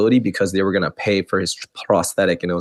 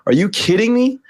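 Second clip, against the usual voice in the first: rising higher in disbelief; incredulous; 8 semitones higher than usual. Retold or imitated. Hearing the VA might cut benefits, rising in disbelief. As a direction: build from stunned to outraged. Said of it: "I was like".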